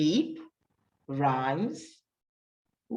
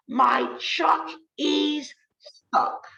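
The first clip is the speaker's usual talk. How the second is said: high, slow duck complaint; 9 semitones higher, much slower than usual. Acted. Duck answering the sheep, slow and high. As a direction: whine it slowly as the duck.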